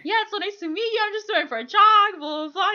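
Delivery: high, bright imitation; bubbly mock greeting. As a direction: breeze in like a friendly star. Voicing Tom Hanks cheerfully.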